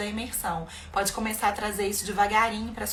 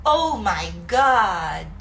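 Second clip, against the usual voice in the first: a very slow, louder oh my God; drawn-out oh my God; 2 semitones lower, 5 dB louder, much slower than usual. Shown as a demonstration. Teacher showing easy English exclamations, stretched out. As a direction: stretch every word in mock shock. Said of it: "Isso tudo é barulhinho fácil".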